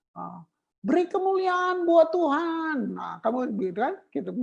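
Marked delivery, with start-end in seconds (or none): 0.1-2.9 higher, slow mock call to give glory; mock worship leader call. Preacher parodying a worship leader's call, mock high and slow. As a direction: parody the stock stage prompt. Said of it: "Coba kau, kau bilang".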